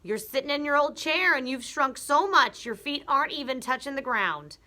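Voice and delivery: high, loud triumphant shout; husband shouting at wife in chair; shouting Mr Twit. Acted. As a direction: bellow it, pointing. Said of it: "Mister Twit pointed at her and shouted".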